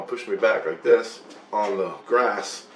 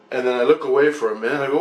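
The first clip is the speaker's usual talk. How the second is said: ordinary talk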